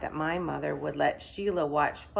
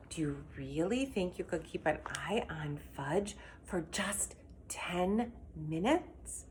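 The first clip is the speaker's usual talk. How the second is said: quiet; 7 dB quieter than usual. Acted. Mother quietly asking.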